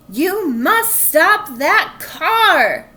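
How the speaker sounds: frantic slow shout. A narrator's reading. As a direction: bellow an urgent order. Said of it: "shouted Officer Tamilio".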